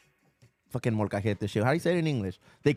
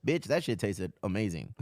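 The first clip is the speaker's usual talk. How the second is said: ordinary talk, nothing marked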